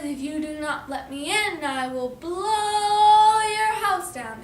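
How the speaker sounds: high, loud, long-drawn storybook threat; big bad wolf threat. Wolf threatens pigs at the door, loud drawn-out menace. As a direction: build up and stretch BLOW hugely.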